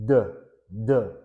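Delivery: "the, the" as two isolated "duh" sounds; voiced d sound. Shown as a demonstration. Teacher demonstrating throat vibration, "the, the" as voiced "duh, duh". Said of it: "There's voice".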